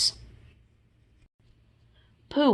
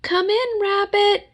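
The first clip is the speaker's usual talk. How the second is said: calling out; 14 semitones higher, 3 dB louder than usual. Acted. Calling through the door.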